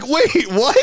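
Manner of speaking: high, startled "wait, what?" through a laugh; laughing disbelief; shocked disbelief. Retold or imitated. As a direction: jump up in pitch, caught completely off guard. Shocked at pilot's fan remark.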